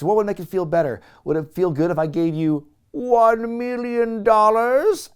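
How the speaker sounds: mock Doctor Evil. Wrestler mocking Vern, lightly hammed-up Doctor Evil. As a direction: ham up the villain's offer. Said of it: "he asks".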